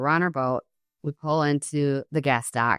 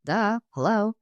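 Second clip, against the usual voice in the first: matter-of-fact duh; 6 semitones higher than usual. Retold or imitated. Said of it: "he says it so matter-of-factly".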